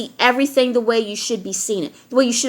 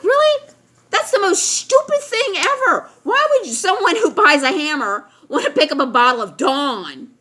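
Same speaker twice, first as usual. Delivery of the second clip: much higher, squeaky mock disbelief; 6 semitones higher than usual. [name] mocking the customer who believes her, in high incredulous disbelief. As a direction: shriek it like it's the dumbest idea ever.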